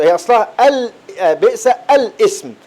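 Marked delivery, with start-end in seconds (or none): 1.3-2.5 بئس الاسم said slowly with a long first vowel in الاسم; slow teaching recitation of بئس الاسم; original form. Shown as a demonstration. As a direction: sound out الاسم as it was originally written. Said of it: "يعني هي أصلها كانت كده".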